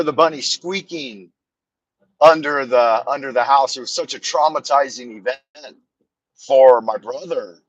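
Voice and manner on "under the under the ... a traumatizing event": ordinary talk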